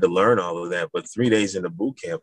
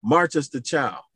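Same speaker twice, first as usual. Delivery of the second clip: retold command; 9 semitones higher than usual. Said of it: "He said".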